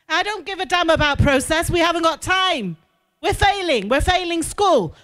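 mock shouted rebuke; retold angry outburst. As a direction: bark back the frustrated colleague's complaint. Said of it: "I'd say".

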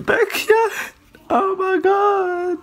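high, loud tearful fan squeal; crying squeal. Real, not acted. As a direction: whimper with overwhelmed joy. Got her favourite's card.

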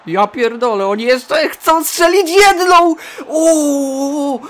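loud, high wail building to a long "O"; panicked outcry. Real, not acted. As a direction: wail as the danger unfolds.

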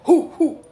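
high, loud "hoo hoo" whoops; loud hoots. Real, not acted. Hooting exclamation.

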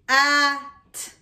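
"At" split into h, a, t with gaps; sound by sound. Shown as a demonstration. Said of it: "to the middle sound".

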